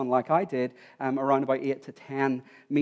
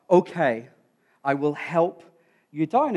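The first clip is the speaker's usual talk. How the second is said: ordinary talk, nothing marked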